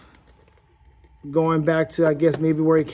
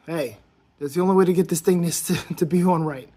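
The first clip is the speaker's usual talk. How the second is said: ordinary delivery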